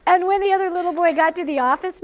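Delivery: can barely get the words out for laughing; giggling while telling the punchline. Real, not acted. Teacher cracks up retelling.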